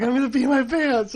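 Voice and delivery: high, loud, laughing through the line; laughing little girl quote. Retold or imitated. Dad quoting his daughter, laughing high and loud. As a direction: giggle out a kid's urgent plea. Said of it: "She's like".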